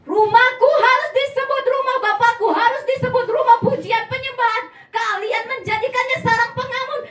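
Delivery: loud, high rebuke in the temple; angry Jesus. Acted. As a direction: thunder at the traders in the temple. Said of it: "marah-marah di rumah bait Allah".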